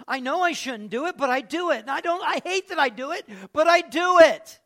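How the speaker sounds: loud, high, rising exasperation; frustrated confession. Acted. Struggling believer admitting the sin, loud and exasperated. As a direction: let the frustration burst out on the last line.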